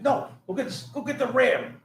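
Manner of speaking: high, insistent captain's order. Retold or imitated. Captain ordering the sergeant, insistent and high. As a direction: insist on the ram. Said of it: "He's like".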